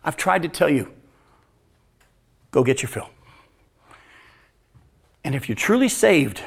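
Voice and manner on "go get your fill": softer, lower resigned permission; quiet resigned release. Retold or imitated. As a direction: let it go gently. Repeat of the father's words.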